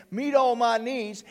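usual speaking voice

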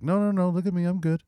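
high, preachy self-righteous front; lightly voiced smug celebrity; Bill Cosby impression. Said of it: "So he was just like".

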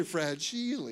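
movie-joke mispronunciation of FRAGILE, "frah-JEE-lay". Shown as a demonstration. Preacher reminding listeners of a movie joke, FRAGILE as "frah-JEE-lay". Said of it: "the Christmas story".

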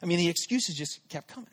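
usual speaking voice

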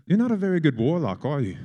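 mock the warlock in a light English accent. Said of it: "I've fallen into an English accent".

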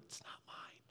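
unvoiced whispered confession. Real, not acted. Admitting the land isn't his, whispered aside. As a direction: breathe it out like a guilty secret.

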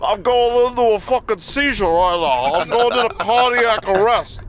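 choked nasal New York voice; strangled voice. Pretend. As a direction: squeeze it out like choking. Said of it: "you sound like you're choking on your tongue".

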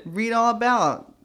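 loud, high street vendor cry; mock newsboy shout; newspaper seller. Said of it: "people would be screaming Echo".

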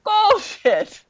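Bullshit squeaked very high in playful disbelief; squeaky mock disbelief. Real, not acted. Teasing the co-host who yelled at his TV, squeaky high. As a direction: pitch it way up like a cartoon gasp.

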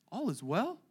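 "All is well?" asked softly, rising in doubt; questioning. Shown as a demonstration. Preacher questioning the mother's answer, softly. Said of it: "which is an interesting response".

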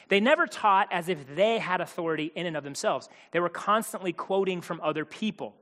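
ordinary talk

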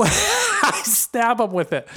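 high-pitched, laughing through the words. Real, not acted. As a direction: crack up on your own joke. Joking aside breaks into laughter.

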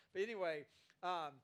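ordinary talk, nothing marked

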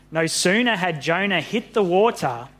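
ordinary delivery

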